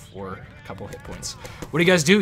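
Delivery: ordinary delivery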